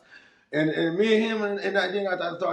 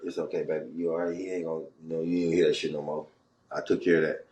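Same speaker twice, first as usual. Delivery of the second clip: tender comfort; 9 semitones lower, faster than usual. Retold or imitated. Comforting the lady after fighting.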